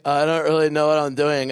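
put-on dopey confusion; mumbling along, lost and hesitant; clueless player. Leader mimicking confused players, put-on clueless voice. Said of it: "If you're just like".

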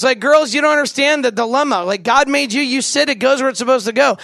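ordinary delivery

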